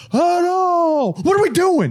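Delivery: high, loud yell at the center; pissed coach. Retold or imitated. Football coach yelling at the center, mock furious high yell. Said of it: "the coach always get pissed at the center".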